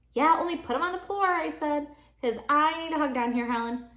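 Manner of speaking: put-on high, loud and fast kid; bossy little girl wanting a hug; demanding child.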